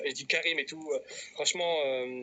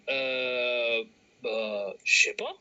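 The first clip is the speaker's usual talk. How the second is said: imitated clueless hesitation; 5 semitones lower, 4 dB louder, much slower than usual. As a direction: play someone completely lost for an answer. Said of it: "il est en mode". (imitation d'un gars largué qui hésite)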